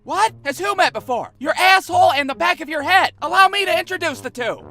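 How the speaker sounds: high, loud mocking ring banter; taunting wrestler. [name] taunting bent-over Michael, high and loud. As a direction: rub it in with a grin. Kids taunting during match.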